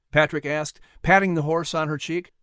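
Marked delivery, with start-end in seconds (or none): none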